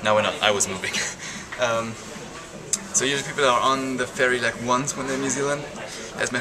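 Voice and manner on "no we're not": normal talking voice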